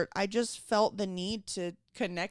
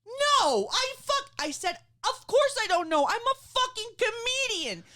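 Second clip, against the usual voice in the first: mock angry rant; 11 semitones higher than usual. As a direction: rail at her high and hard. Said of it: "And I'm like".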